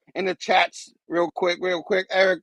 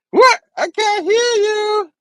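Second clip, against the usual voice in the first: pretending not to hear; 13 semitones higher, 3 dB louder, slower than usual. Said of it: "am I on mute?".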